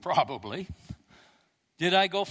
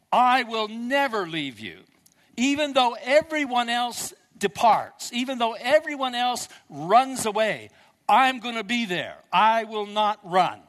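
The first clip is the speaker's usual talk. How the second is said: Peter vows loudly and a little higher, never leaving; 7 semitones higher, 7 dB louder than usual. Acted. Peter insisting to Jesus, loud defiant vow.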